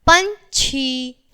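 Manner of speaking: very slow, each sound stretched; drawn-out example word. Shown as a demonstration. Teacher says example word for class. (शब्द को खींचकर धीरे बोलना)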